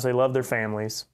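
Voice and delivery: ordinary talk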